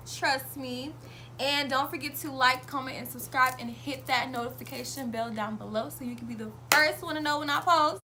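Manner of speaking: loud, bright, pumped-up channel intro; YouTuber intro voice. Acted. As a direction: sell it like a hyped host.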